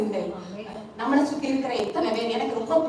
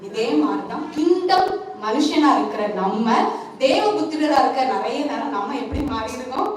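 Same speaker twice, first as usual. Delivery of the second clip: ordinary talk, nothing marked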